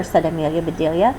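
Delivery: plain reading voice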